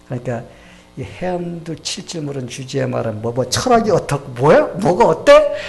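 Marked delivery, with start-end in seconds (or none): 3.2-5.5 loud, rising rebuke that climbs much higher at the end; scolding. Retold or imitated. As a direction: tell him off hard. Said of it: "막 꾸짖었습니다".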